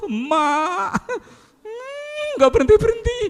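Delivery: put-on high, whiny child complaining about mom. Child complains mother never stops scolding, high and whiny.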